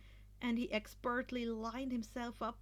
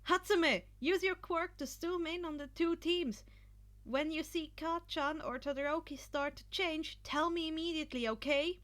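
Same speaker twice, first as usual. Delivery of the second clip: raised, quick commanding instructions; urgent orders; 6 semitones higher, 4 dB louder than usual. A narrator's reading. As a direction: Izuku orders Hatsume to watch the teams, urgent. Izuku giving orders.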